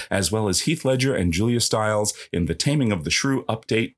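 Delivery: ordinary talk, nothing marked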